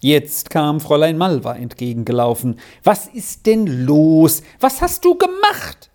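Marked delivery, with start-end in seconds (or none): 2.8-5.9 loud scolding, leaping high at the end; half-alarmed, half-angry. A narrator's reading. Fräulein Malva confronts the screaming child, alarmed and annoyed. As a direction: demand it, frightened and cross at once. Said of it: "halb in Schrecken und halb in Ärger".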